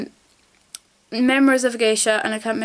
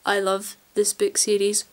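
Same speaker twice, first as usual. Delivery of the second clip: normal talking voice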